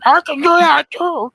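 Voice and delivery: high, loud quacky duck voice. Shown as a demonstration. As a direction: squawk it out like a cartoon duck. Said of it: "if you just want me to do a duck voice".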